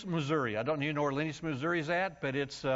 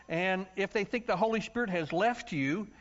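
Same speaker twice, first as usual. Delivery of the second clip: ordinary talk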